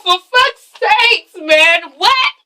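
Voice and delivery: high-pitched, drawn-out mock exasperation; exasperated outburst. Yelena reacting to Renee's news, exasperated outburst. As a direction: act out fed-up disbelief way up high.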